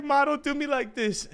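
high, whiny pleading to mother; acted tearful plea. Son begging Mom not to make him cry, high plea. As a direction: plead high, as if close to tears. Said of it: "I was about to start crying".

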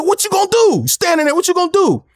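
high-pitched goading demand; acting out an aggressive taunt; confrontational challenge. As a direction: get in his face and dare him. Acts out rival's challenge.

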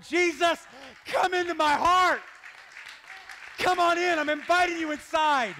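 loud, high, impassioned plea; crying out. Acted. Believer right here inviting Jesus inside, impassioned shout. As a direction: shout the invitation at full force.

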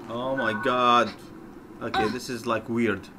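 ordinary talk, nothing marked

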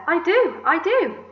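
high, loud, eager reply; excited agreement. A narrator's reading. Aunt agreeing with her sister, excited.

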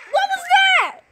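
high surprised what was that; startled. Real, not acted. Gamer startled mid-play, high surprised question. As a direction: caught off guard by the screen.

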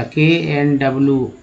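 normal talking voice

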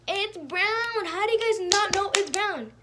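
high, pushed disbelief at her crewmates; can't believe they don't see it; exasperated insistence. Real, not acted. Insisting the body colour is brown.

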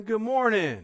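energetic called greeting. Real, not acted. Calling out a greeting to the church.

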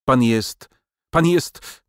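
high, agitated stammer of outrage; choked outrage. A narrator's reading. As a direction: start to accuse, break off and start over. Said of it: "podbródek jej drżał spazmatycznie".

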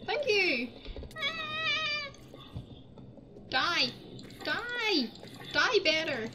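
jab each word at the enemy; fierce taunting. Real, not acted. Player taunting the zombie while hitting it.

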